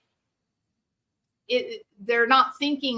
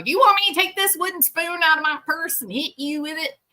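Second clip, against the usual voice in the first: threatening parent; 8 semitones higher, faster than usual. Retold or imitated. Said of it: "Like, you know".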